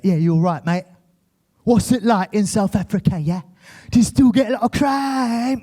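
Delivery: mocking brother's put-on UK accent; chummy mock-British bloke; hammed-up British accent, rising at the end; fake British accent. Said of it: "been in the U K for".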